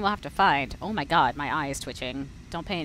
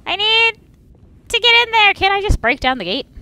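much higher, louder, exasperated echo; mocking whine; 11 semitones higher, 11 dB louder than usual. Streamer mocks the character's repeated need for the key, high and whiny. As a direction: mimic the character's complaint.

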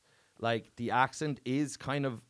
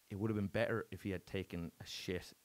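usual speaking voice

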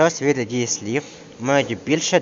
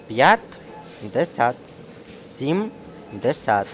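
П'ЯТДЕСЯТ, СІМДЕСЯТ very slowly split, landing on СЯТ; drawn-out syllables with the beat on SIAT; stress on last syllable; much slower than usual. Shown as a demonstration. Teacher dictating numerals, stress on last syllable. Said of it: "падає на останній склад".

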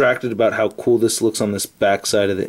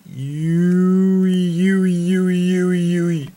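high sustained you; 8 semitones higher, much slower than usual. Pretend. Repeated high drawn-out you.

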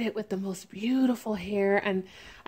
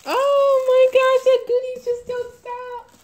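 loud, very high squeal of delight; thrilled high-pitched outburst; overjoyed exclamation; 13 semitones higher, 8 dB louder than usual. Real, not acted. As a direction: squeal at endless presents, starting big. Overjoyed at many gifts.

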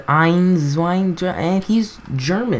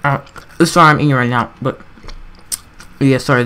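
usual speaking voice

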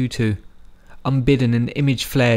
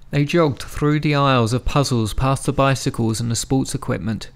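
plain reading voice

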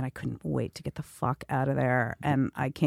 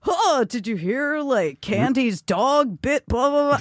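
higher, slow gossipy neighbor; dog park gossip; 8 semitones higher, slower than usual. Retold or imitated. Imitating dog park owners trading gossip, higher and slow. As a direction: mimic the dog-park chatter, drawn out. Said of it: "they'd be like".

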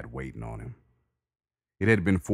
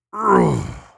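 loud high effort grunt; straining grunt shout; 12 dB louder than usual. Acted. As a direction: grunt out the final rep. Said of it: "he shouted as he finished".

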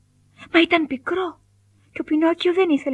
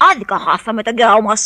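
louder, grumbling rabbit complaint; 4 semitones lower, 5 dB louder than usual. A narrator's reading. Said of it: "γκρίνιαξαν τα κουνέλια". (γκρινιάρικο παράπονο)

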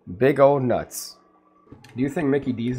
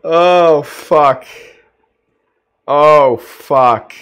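much louder stunned curses; shocked swearing; 3 semitones higher, 13 dB louder, much slower than usual. Real, not acted. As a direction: blurt it out in real shock. Stunned by McFlurry at door.

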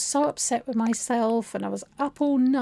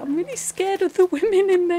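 mock scared; 8 semitones higher, 5 dB louder than usual. Retells pleading with receptionist.

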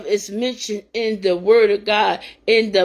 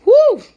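joyful whoop; 13 semitones higher, 8 dB louder than usual. Real, not acted. Praise outburst.

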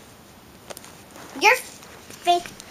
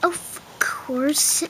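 soft unvoiced aside; whisper; 7 dB quieter than usual. Pretend waiter whispers agreement to the customer. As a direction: whisper it knowingly.

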